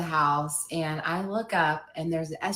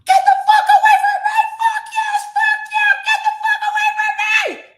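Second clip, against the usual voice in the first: shrieking at top pitch, furious and terrified; high, frantic screaming curse; screamed demo; 10 dB louder, faster than usual. Shown as a demonstration. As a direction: scream bloody murder at an attacker. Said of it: "I can demonstrate it for you".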